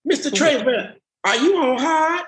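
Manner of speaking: high mock voice of a teasing girl; mimicking her question. Retelling how she interrupted him, in a high mock girl's voice. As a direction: pitch it up to play the classmate who cut in. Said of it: "you came in and you said".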